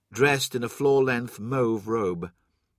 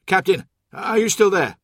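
loud, higher-pitched call down the link; urgent radio call; 7 semitones higher, 8 dB louder than usual. A narrator's reading. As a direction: call out to someone you're losing contact with. Calling into a failing radio link.

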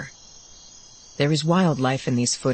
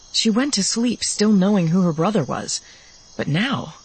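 ordinary delivery, nothing marked

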